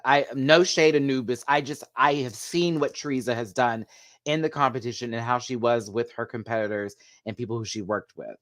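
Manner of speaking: normal talking voice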